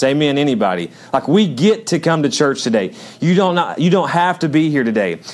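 ordinary delivery

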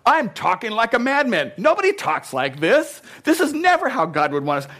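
high, loud, worked-up rant; going nuts. Acted. Preacher voices Paul appalled at his own boasting, ranting loudly. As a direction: shout it like a man losing it. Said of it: "He's going kinda nuts here".

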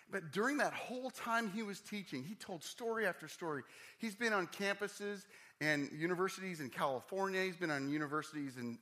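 high, loud preacher in full flow; impassioned preaching. Real, not acted. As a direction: preach it with building force. Preaching with rising force.

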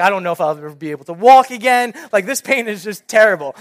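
mock tragic complaint; hammed-up dramatic moaning. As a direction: play up the pain like a drama queen. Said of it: "I can just be super dramatic".

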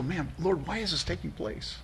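soft tearful lament; weeping plea. Acted. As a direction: let it break quietly, almost weeping. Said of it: "do you weep".

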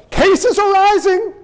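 high, slow panicky announcement; mock the fearmongering headline; hammed-up panic, pitched high; alarmist news voice.

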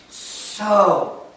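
"So" drawn out and punched; stress on "So". Shown as a demonstration. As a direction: land hard on a long "So". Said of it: "The word so".

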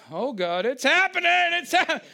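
high, loud panicked cry; mock sheep panic. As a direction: squeal it like a sheep tipping over. Sheep panics before falling over.

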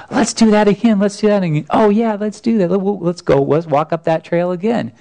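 put-on high, eager child; high kid voice, excited; little girl's voice. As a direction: beg to go again like a thrilled kid. Daughter begging to go again.

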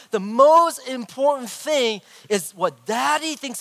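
high, loud, emphatic retold lecture; mock-preach with rising insistence; hammed-up fatherly sermon, driving each point home; preaching at his daughter. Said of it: "preaching to her".

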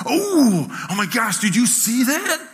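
high, loud, drawn-out "oh" of amazement; gasping kid who can't believe what they saw; excited son. Retold or imitated. Son shouting to dad at the play area, amazed. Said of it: "I hear Jonathan go".

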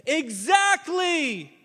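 "Exactly!" shouted high and drawn out slowly; emphatic shout. Acted. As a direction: belt it out like the whole crowd agrees. Congregation's imagined shout.

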